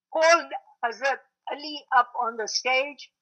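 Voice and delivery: ordinary delivery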